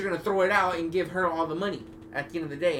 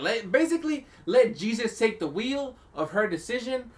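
high mock preachy voice, higher; mocking friend; 6 semitones higher, 3 dB louder than usual. Friend's advice about the prenup, retold in a high mocking voice. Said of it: "He was like".

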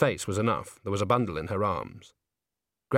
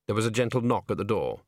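ordinary delivery, nothing marked

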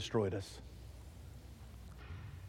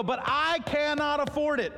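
mock protest; 11 semitones higher than usual. Pretend excuse to God.

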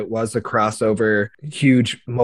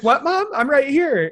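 put-on kid voice; 14 semitones higher than usual. Kid voice retold.

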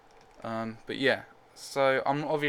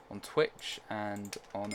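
usual speaking voice

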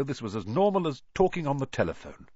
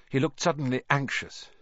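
plain reading voice

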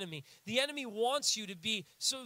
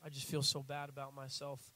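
mopey self-pity; 7 semitones lower, 5 dB quieter than usual. Retold or imitated. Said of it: "I go".

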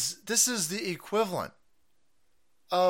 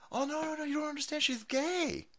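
soft, mock-earnest objection; mock pleading defender; 4 semitones higher, 8 dB quieter than usual. Defenders excuse the official, mock soft pleading. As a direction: plead gently in a put-on voice. Voices her defenders mockingly.

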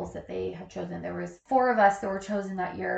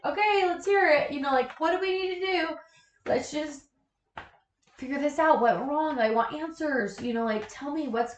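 eager for answers; 5 semitones higher, 3 dB louder than usual. Retold or imitated. Retold demand for answers, eager.

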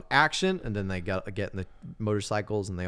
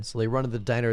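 ordinary delivery

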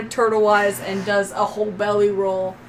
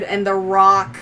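ordinary delivery

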